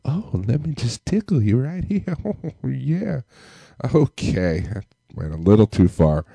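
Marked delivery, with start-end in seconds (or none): 0.1-3.2 hammed-up sultry woman, much higher and softer, breaking into a laugh; mock sexy female voice. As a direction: camp it up as a seductive lady, then laugh. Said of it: "hot, sexy female voice".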